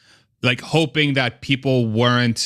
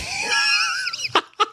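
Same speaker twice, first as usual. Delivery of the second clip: shrieking burst of laughter. Real, not acted.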